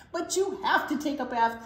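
insistent cry. A narrator's reading. Bear urges Skunk to take a bath, insistent cry. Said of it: "cried Bear".

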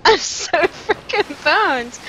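very high, slow, half-crying laugh; real laughing wail of defeat; laughing despair. Real, not acted. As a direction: whine it through tears of laughter. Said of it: "I'm so boned".

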